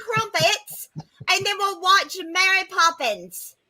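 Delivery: high, slow, hammy British accent; overdone Cockney girl voice. Shown as a demonstration. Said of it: "just even attempt a British accent".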